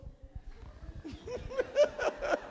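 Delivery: high, unvoiced laughing; breathy laugh. Real, not acted. Pastor laughing at the congregation's prayer. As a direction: laugh silently at the joke.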